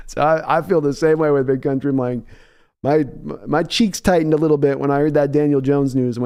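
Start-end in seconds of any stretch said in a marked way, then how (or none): none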